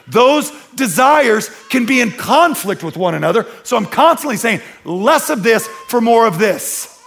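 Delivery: loud, high-pitched pulpit push; fiery preaching. Acted. Pastor pressing his church on competing desires, preaching loudly. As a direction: drive it home to the congregation.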